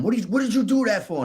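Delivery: high, angry shout at the medics; a hammed-up furious demand; retold angry yell. Angry overdose survivor yelling at the medics. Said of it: "So I started yelling at them".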